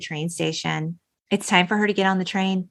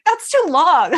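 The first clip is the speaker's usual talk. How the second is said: laughing; 9 semitones higher, 4 dB louder than usual. Real, not acted. Laughing comment on five years.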